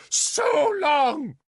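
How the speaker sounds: loud, high ringing shout; crying out. Acted. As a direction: cry it out over the hall. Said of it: "she cried again".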